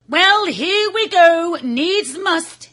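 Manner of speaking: voiced high and slow, bracing for the jump; a plucky rat steeling himself, slowly. Big rat to himself before swinging, high and drawn out. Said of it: "he said to himself".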